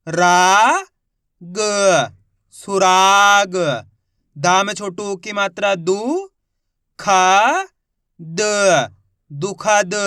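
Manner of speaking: each syllable called out slowly and loudly, then the whole word drawn out; teacher spelling each syllable aloud; syllable-by-syllable reading. Shown as a demonstration. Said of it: "मैं किस तरीके से एक एक अक्षर का उच्चारण करके इनको पढ़ रहा हूँ". (अक्षर-अक्षर ज़ोर से बोलकर पढ़ाना)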